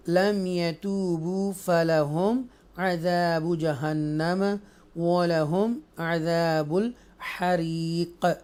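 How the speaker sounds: slow chanted tajweed reading; measured chanted recitation; Quranic recitation. Shown as a demonstration. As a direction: recite it slowly and melodically. Recites verse in lesson.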